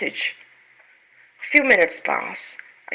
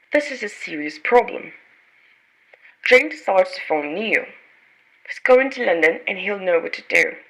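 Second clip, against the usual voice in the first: ordinary delivery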